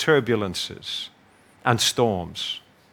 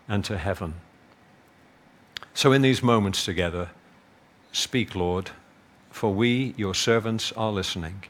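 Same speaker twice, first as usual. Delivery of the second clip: soft, low, reverent prayer; 4 semitones lower, 4 dB quieter than usual. Acted. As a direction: pray it softly and humbly. Leads a closing prayer.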